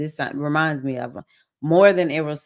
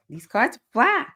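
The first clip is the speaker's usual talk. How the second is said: higher, sing-song, rising on "fly"; playful repeat; 6 semitones higher than usual. Real, not acted. To viewers admiring the deck, sing-song "fly". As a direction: savor "fly" with a lift, showing off.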